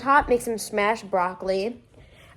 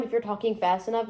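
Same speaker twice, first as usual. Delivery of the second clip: usual speaking voice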